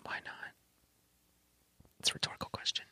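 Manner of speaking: hushed unvoiced whisper. Host whispering a playful aside to co-host, whispered. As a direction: whisper it low into the mic. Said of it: "Why are we whispering?".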